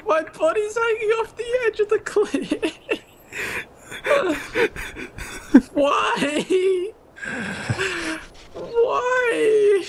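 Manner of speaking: high laugh-crying wail; crying with laughter. Real, not acted. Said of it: "I'm crying".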